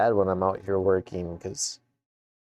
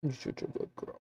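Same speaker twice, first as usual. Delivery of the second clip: talking to a dog; 12 semitones higher, 5 dB quieter than usual. Real, not acted. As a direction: coo softly at the puppy. Cooing at the dog.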